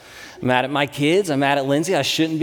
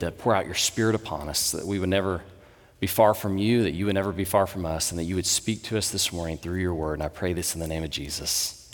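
prayer; 4 semitones lower than usual. Acted. Opening prayer before the sermon.

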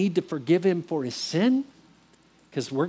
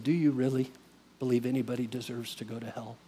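low, hushed, gentle question; quiet voice of gentle challenge; gentle Jesus; 6 semitones lower, 8 dB quieter than usual. Retold or imitated. Jesus gently questions him, retold.

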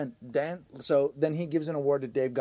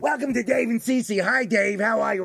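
higher-pitched mock rocker show host; 4 semitones higher, 4 dB louder than usual. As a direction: put on a raised rocker voice to open a show. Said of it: "That's my only imitation".

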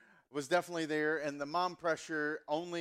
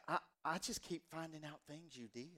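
softer Southern mom, mock dismay; mom's Southern accent; 9 dB quieter than usual. Mom complaining to her son about new discoveries, soft Southern accent. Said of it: "every time I do my mom's accent".

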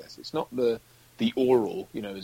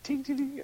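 high little metallic tinging; imitated penny drop; 14 semitones higher than usual. Describing a film's sound mix, imitated coin clink. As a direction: tinkle like a coin landing. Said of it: "a penny drop hitting the ground".

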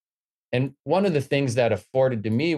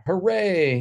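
higher-pitched mock cheer; recalling his own naive delight with a lifted hooray; 9 semitones higher than usual. New Princeton student thinking he has escaped home, naively elated. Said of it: "very naively thought".

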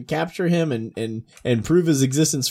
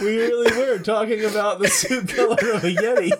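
high-pitched words breaking into laughter; real helpless giggling through the sentence; laughing hard; 8 semitones higher than usual. Real, not acted. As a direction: try to explain while cracking up. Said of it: "last time I laughed that hard".

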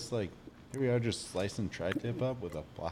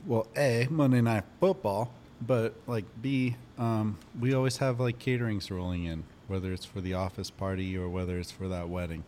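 normal talking voice

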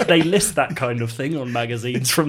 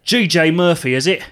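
high, loud mocking query; brash sneer; 6 semitones higher, 7 dB louder than usual. A narrator's reading. Salesman reading Gav's name tag, brashly mocking. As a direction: read the name out loudly.

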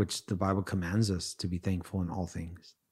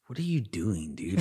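exasperated. Retold or imitated. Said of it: "I'm just shaking my head".

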